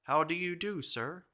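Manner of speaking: stiff formal greeting. Retold or imitated. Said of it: "with a stare and a civil but formal bow".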